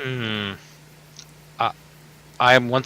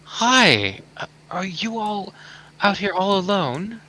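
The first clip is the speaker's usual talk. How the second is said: gentle; 7 semitones higher than usual. Acted. Adventurer asking the lost girl about herself, gentle. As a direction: ask kindly so she is not scared.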